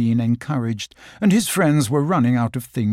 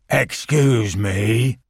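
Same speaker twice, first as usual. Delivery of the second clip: slow, dignified donkey; with great dignity; 3 semitones lower, much slower than usual. Acted. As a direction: draw yourself up with grave dignity. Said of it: "with great dignity".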